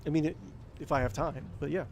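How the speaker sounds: usual speaking voice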